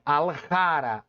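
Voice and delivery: "Aljara" slow, with a strong, drawn-out ja; strong middle syllable. Shown as a demonstration. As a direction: lean hard on the ja of "Aljara", said like ha. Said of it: "O ra é uma sílaba forte".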